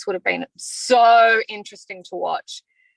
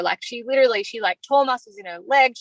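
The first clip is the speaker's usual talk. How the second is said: ordinary delivery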